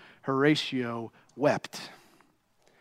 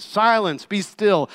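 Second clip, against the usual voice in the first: louder, firm command; stern rebuke; 6 semitones higher, 6 dB louder than usual. Acted. Jesus rebuking the storm like a parent scolding a child. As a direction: correct the wind like a scolding parent. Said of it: "Like a parent correcting their disobedient child".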